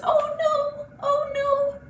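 high little worried cat voice; dismayed mama cat. Acted. Mama kitty seeing her painted kittens, high dismay. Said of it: "Mama kitty says".

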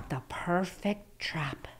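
hushed whisper, slow. Acted. Grandma whispers the hint to the kids, hushed. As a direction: breathe it like a secret.

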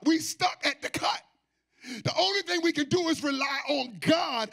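full-force preaching. Acted. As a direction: preach it out, half singing.

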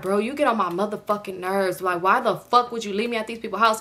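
ordinary delivery

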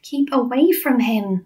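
mildly anxious, drawn-out request; pleading. A narrator's reading. Sophie begging BFG to avoid giant, mild pleading. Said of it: "Sophie pleaded".

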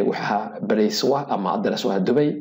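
ordinary talk, nothing marked

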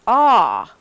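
ah, loud, jaw dropped wide. Shown as a demonstration. Teacher showing the open ah sound. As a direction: drop the jaw for ah. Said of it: "drop my jaw to say this".

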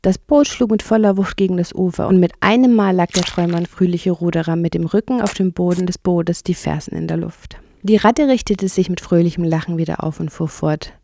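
usual speaking voice

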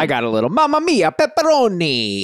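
a lightly hammed-up Italian accent; mock Italian accent. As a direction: toss off a jokey Italian accent. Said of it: "give us a taste of your Italian".

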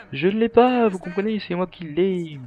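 high-pitched hammed-up protest; mock protesting character. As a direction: plead in a put-on high voice. Mimicking a game character pleading.

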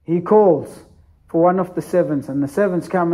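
normal talking voice

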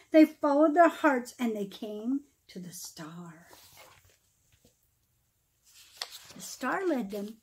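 lively storybook read-aloud, rising and falling; storyteller reading verse aloud; reading to children. Acted. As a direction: read the Christmas rhyme to a room of kids. Reads Christmas poem to children.

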